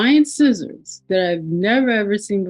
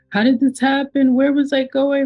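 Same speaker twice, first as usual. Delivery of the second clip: high, distressed mock-crying voice; high-pitched retold wail; imitating shaky crying; 7 semitones higher than usual. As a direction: replay your own panicked, tearful questions in a raised pitch. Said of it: "my voice is shaky, and my voice is crying".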